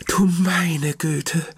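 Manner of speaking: high, slow, drawn-out grandma's dismay; startled grandma. Acted. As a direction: stretch it out as the frightened grandmother. (langsam gedehnt, hohe erschrockene Oma-Stimme)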